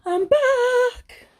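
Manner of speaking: calls out high and loud; bursting back on camera; loud return. Real, not acted. Vlogger returning to viewers, calling out loudly. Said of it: "returning to camera".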